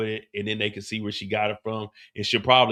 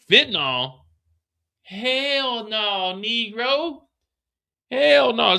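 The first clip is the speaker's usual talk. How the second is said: much higher, outraged woman's voice; mock CO woman; 10 semitones higher, 4 dB louder, much slower than usual. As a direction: play the appalled corrections officer, high pitched. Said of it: "She's looking at him like".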